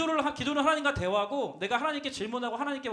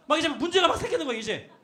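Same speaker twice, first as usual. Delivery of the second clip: ordinary delivery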